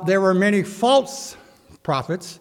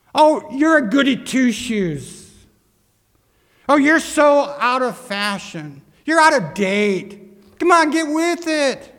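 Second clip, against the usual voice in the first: raised mocking tone with stretched words; sarcastic; 6 semitones higher than usual. People mocking someone who took a stand for Christ, sarcastic. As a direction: needle them like a scornful critic. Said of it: "in a sarcastic tone to you".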